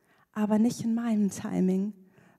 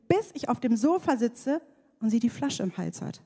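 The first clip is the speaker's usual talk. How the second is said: ordinary delivery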